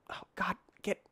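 tense whispered oh god. Real, not acted. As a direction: breathe it out under your breath.

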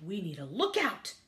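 usual speaking voice

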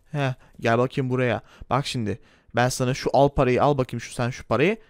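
low, bossy shopkeeper voice; gruff shopkeeper. Acted. Shopkeeper Erdal Bakkal teasing the customer, low gruff voice. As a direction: play the pushy corner-shop owner, pitched low.

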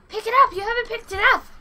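loud, high demand; insistent shout. Real, not acted. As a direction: yell at her to grab the egg now. Child urging mother in game.

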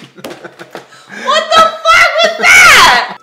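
high, drawn-out, stunned yell; disbelieving shout. Real, not acted. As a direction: scream it out in shock. Disbelief after the prank call.

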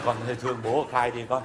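mock pleading hush. Father coaxing his daughter to confess, low hushed plea. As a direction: mumble the plea low and quick.

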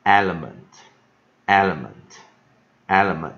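"Element" said slowly and loudly, stress on the first syllable; loud, slow teacher's model of "Element"; pronunciation demo. Shown as a demonstration. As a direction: model "element" for learners, one careful take after another. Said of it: "所以就念什么".

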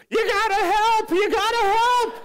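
high-pitched, full-volume screech; frantic plea for help. Retold or imitated. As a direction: scream it like a desperate flight attendant. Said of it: "She looks at me and goes".

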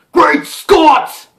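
loud, high-pitched exclamation in character; Doc Brown. Acted. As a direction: cry out like a startled movie scientist. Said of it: "Emmett Brown figure!".

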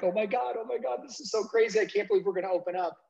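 high, rushed, breathless-paced nervous thrill; heart-pounding excitement. Retold or imitated. Owner to himself minutes before first opening, nervously thrilled. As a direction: race through it in giddy disbelief. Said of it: "my heart is, like, pounding".

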